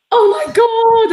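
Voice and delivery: loud, high, drawn-out oh my God; retold shock. Retelling being picked as Stephen's partner, mock-shocked cry. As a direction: act out being horrified at getting picked.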